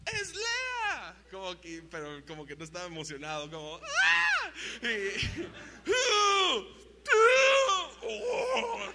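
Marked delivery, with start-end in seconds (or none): 0.1-1.1 very high horrified shout; exclamation. Retold or imitated. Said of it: "signos de exclamación". 3.8-4.5 very high, loud mock scream of horror; hammed-up high shriek; horrified scream. Jacob waking beside Leah. 5.9-8.9 very high, loud laughing-crying yelps; comic disgusted howls; mock sobbing wails. Jacob's horrified reaction.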